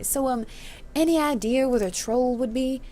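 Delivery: put-on hesitant country boy with a rural accent. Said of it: "I'm gonna make him a country boy".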